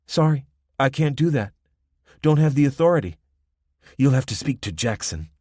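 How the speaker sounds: lightly voiced Spanish accent. A narrator's reading. Young woman refusing.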